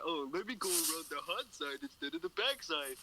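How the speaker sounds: lightly put-on voice mocking your own bad idea; mock dim self.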